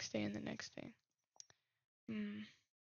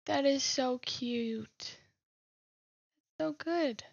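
slow, loud gushing over something cute; 2 semitones higher, 6 dB louder, much slower than usual. Real, not acted. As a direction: coo over the drawing. Gushing at a drawing.

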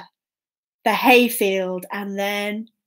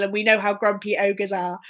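ordinary delivery

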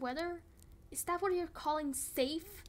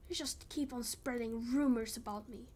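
ordinary talk, nothing marked

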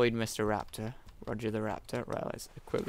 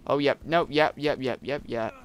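panicked yeps; 3 semitones higher, 7 dB louder than usual. Real, not acted. Player seeing creature return, panicked yeps. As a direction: rattle them off in alarm.

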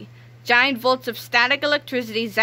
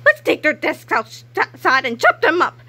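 gleeful cry; 7 semitones higher, 5 dB louder than usual. Acted. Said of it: "cried Miss Rebel".

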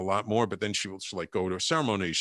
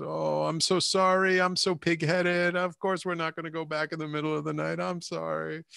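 mock tearful apology; 6 semitones higher than usual. Wife apologising after crying.

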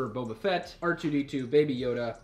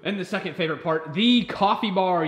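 excited reveal; 5 semitones higher, 10 dB louder than usual. Real, not acted. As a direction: announce it like a grand prize. Excited house tour reveal.

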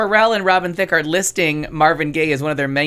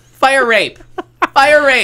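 loud, high cry for help, played for laughs; mock distress shout; 8 semitones higher, 8 dB louder, much slower than usual. Comedian quoting a TV show's scream, mock distress shout. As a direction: yell it out high, like a sitcom alarm.

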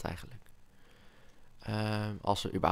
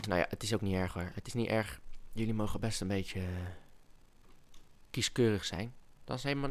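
normal talking voice

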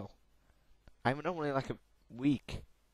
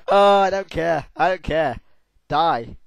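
ordinary talk, nothing marked

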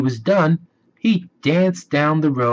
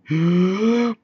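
gasp; 7 semitones higher than usual. Acted. Story wolf runs out of breath.

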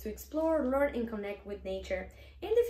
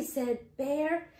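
ordinary delivery

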